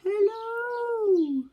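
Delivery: high, long call coming back; echoing call. Acted. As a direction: answer as the echo. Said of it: "said the voice from across the pond".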